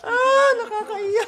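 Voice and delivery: high, drawn-out happy whimper; mock tearful wail. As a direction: wail as if moved to tears by a play. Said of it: "Naiiyak ako sa Lolo connection". (kunwaring naiiyak sa tuwa)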